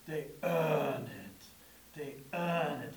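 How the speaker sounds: put-on slow, low New England accent on a drawn-out EARN; old New England accent. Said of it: "He had that old, like, even New England, uh, accent there".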